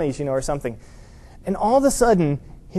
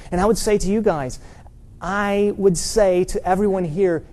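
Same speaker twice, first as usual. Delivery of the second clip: usual speaking voice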